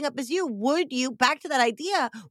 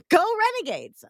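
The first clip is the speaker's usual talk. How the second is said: higher, peppy mock rally cry; mock cheer; 6 semitones higher, faster than usual. Mocking the marketer's school cheer, peppy and higher. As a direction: chirp it like a fake school-spirit chant. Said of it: "That's true. I am".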